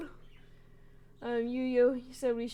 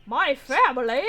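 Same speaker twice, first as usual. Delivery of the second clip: offended; 10 dB louder than usual. Acted. Character offended by family remark.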